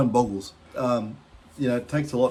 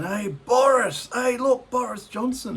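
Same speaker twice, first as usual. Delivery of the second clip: mocking jeer; 11 semitones higher, slower than usual.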